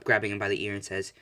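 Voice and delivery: ordinary delivery, nothing marked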